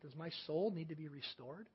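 very soft, inward question; quiet self-questioning. Acted. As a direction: wonder it softly to yourself. Voicing private thought while meditating.

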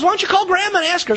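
put-on quick, bright child asking; eager little girl. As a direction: push the idea like an eager child. Girl urges her mom.

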